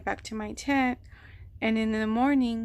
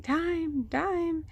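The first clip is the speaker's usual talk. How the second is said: calling out; 7 semitones higher than usual. Retold or imitated. Calling out in dark campsite.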